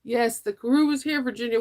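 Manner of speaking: usual speaking voice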